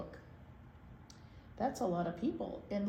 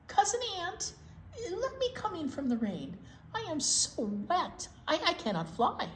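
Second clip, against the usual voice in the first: butterfly voice; 7 semitones higher than usual. Acted. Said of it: "A wet butterfly crawled up".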